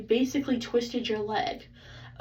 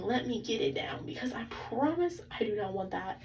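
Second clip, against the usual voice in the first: soft, breathy pleading whisper; whispered begging; 6 dB quieter than usual. Retold or imitated. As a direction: beg the doctor under your breath. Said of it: "I'm begging".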